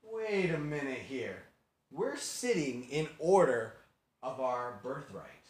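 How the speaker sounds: slow, drawn-out realisation; dawning suspicion. Acted. Brothers notice they sit in birth order, slow suspicion. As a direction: spell it out slowly as it dawns.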